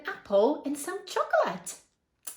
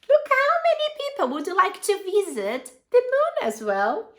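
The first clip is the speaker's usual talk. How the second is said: high, fast and loud with delight; excited; 6 semitones higher, 5 dB louder, faster than usual. Real, not acted. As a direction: marvel at the crowd. Excited about the tourists.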